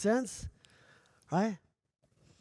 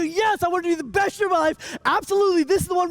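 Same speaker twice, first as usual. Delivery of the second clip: high, loud excited shout; fired-up crowd; 10 semitones higher, 9 dB louder than usual. Retold or imitated. Audience answers the speaker, loud high eager shout. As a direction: yell it like a pumped-up fan. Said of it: "People go".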